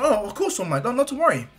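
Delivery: put-on high, eager boy voice; Izuku. Boy gladly helping his idol, high and eager. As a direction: agree at once, pitched well up. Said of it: "Izuku says".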